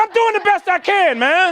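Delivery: high-pitched, pleading comeback; exasperated protest. Retold or imitated. Struggling swimmer answering the waiting group, exasperated protest.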